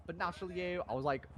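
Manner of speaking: ordinary talk, nothing marked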